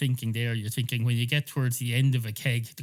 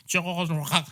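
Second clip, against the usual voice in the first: exaggerated French attempt at the word; French accent; 4 semitones higher than usual. Shown as a demonstration. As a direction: have a go at sounding French. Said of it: "having a go at a French accent".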